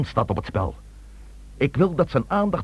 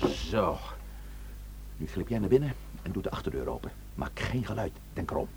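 softer, fast hushed orders; 4 semitones lower, 10 dB quieter than usual. Acted. Chief quietly ordering the burglar in, hushed. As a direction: keep your voice down while giving orders. Maak geen geluid.

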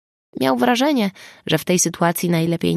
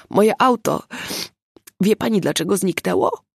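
choked out; 3 semitones higher than usual. A narrator's reading. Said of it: "wykrztusił".

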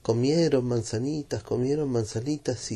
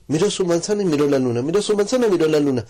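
fast, louder rapid-fire repetition; building pace; 6 semitones higher, 6 dB louder, much faster than usual. A narrator's reading. Narrator speeding up the looking back and forth, building pace. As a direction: rattle it off faster and faster.

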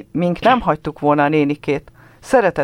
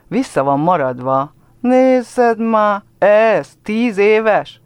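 mocking girls; 7 semitones higher, 5 dB louder, much slower than usual. A narrator's reading.

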